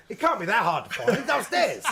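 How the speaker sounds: amused, incredulous exclamation; laughing retold quip. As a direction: crack up while delivering the punchline. Laughing through his own retold quip.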